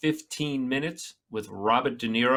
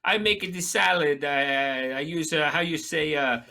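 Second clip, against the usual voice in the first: a put-on Spanish accent, groping for the word; mock Spanish accent. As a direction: parody of a phony Spanish accent. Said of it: "on a morning show going".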